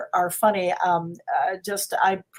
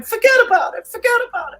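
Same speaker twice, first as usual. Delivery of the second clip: put-on much higher, louder Godfather-style Brooklyn accent; Brooklyn mobster stepsister; 14 semitones higher, 7 dB louder, faster than usual. Stepsister in the Fairy Godmother sketch, high and loud in a Brooklyn accent. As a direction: squeal it like a kid playing a mobster. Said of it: "the stepsister's going".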